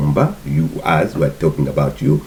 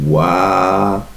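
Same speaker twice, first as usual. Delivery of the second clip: usual speaking voice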